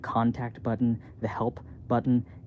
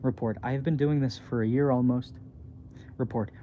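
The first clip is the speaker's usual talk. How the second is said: usual speaking voice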